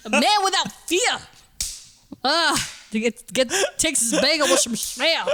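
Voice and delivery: a cartoon New Yorker ordering food; New York accent. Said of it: "New York accent riffing".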